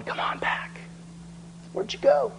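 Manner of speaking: coaxing whisper, rising at the end; luring world voice. Acted. The world calling the believer back, whispered coaxing. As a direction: beckon them back like a temptress. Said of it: "And there's the world going".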